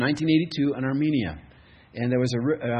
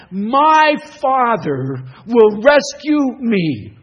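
slow heartfelt declaration; 9 semitones higher, 13 dB louder, much slower than usual. Acted.

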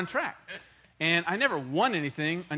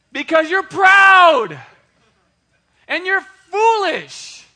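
much higher, louder, slow rebuke; preaching shout; 12 semitones higher, 15 dB louder, much slower than usual. Real, not acted. Preacher calling out young men, slow booming shout.

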